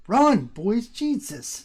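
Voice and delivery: ordinary talk, nothing marked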